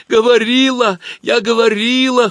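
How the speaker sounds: high loud whining cry; whimpering. Acted. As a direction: whine it near tears. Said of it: "хныкала Галка".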